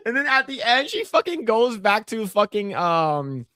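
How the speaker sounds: usual speaking voice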